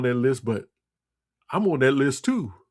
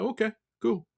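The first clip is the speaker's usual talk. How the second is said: higher and softer, breezy brush-off; dismissive okay; 6 semitones higher, 6 dB quieter than usual. Acted. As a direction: shrug it off lightly, up high and soft. Brushing off a provocative customer.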